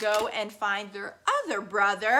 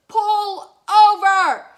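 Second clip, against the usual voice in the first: slow, higher and much louder; big bad robot yelling pull over; robot demand; 8 semitones higher, 10 dB louder, slower than usual. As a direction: bellow the order. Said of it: "this big bad robot said".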